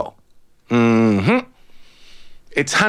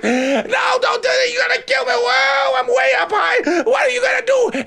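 much higher, shrieking chicken; terrified scream. A narrator's reading. Chicken screaming as the eagle carries it up, terrified. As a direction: scream in panic high in the sky. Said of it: "screaming from the top of its lungs".

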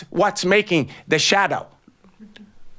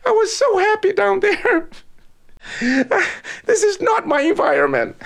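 much higher, tearful begging; crying plea. A narrator's reading. Chicken begging the eagle to bring it down, crying. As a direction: beg to be put down, near tears.

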